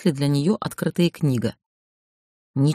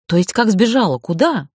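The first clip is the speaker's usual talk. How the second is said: astonished; 4 semitones higher than usual. A narrator's reading. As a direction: blurt it out in amazement. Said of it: "изумилась я".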